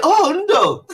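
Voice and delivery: high, loud, drawn-out "oh, ndo"; exclaimed mock apology. Colleagues saying sorry in her language, exaggerated exclamation. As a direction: imitate colleagues exclaiming in Igbo.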